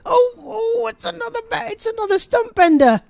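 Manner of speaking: high, loud delighted cry; excited. Real, not acted. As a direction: cry out at the wicket. Wicket falls, commentator erupts.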